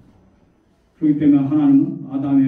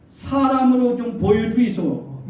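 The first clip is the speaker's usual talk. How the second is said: higher, drawn-out Gyeongsang pleading; pestering plea; 6 semitones higher, slower than usual. Retold or imitated. Grandmothers pestering God to appear as a person, pleading. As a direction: beg insistently like a Gyeongsang granny. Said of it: "이렇게 막 졸아대니까".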